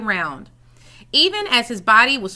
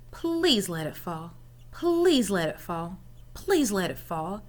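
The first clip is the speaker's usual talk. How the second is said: inner voice; 4 semitones lower, 6 dB quieter than usual. A narrator's reading. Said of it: "he said in his head".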